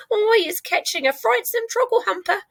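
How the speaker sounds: very high, alarmed giant's voice; giant crying out. A narrator's reading. Giant telling Sophie his catch, high and alarmed. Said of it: "he cried".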